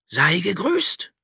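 slow, high, solemn dragon greeting. A narrator's reading. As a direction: greet him like an ancient dragon. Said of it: "sprach der Drache". (Drache, langsam und feierlich)

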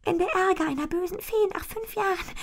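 put-on high, fearful little girl's voice; frightened whisper. A narrator's reading. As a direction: whisper the warning to her pony. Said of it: "Leise flüstert sie". (ängstliches, hohes Flüstern zum Pony)

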